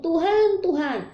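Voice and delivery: high, crying out Lord, Lord; pleading cry. Acted. Quoting pleading followers. (seruan memohon dengan nada tinggi)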